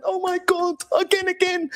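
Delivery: high, childish mock cheer of online fans. Mocking online fans, childish high voice. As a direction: squeal it like a Teletubby fan. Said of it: "like it's the Teletubbies".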